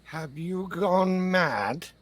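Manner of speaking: high, very slow drawn-out disbelief; aghast disbelief. Acted. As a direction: stretch it out in affronted shock. Acted victim challenges attackers.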